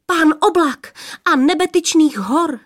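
high, excited outburst; breathless blurting. A narrator's reading. Third maiden naming her father, excited blurting. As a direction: blurt the grand title, voice nearly catching. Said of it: "třetí vyhrkla, až se jí zajík hlas".

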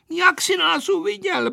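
put-on high, cross girl's question; trembling with anger. A narrator's reading. Aneška questioning Vojta, angry. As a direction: demand it in a high, indignant voice. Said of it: "Její hlas se chvěl zlostí".

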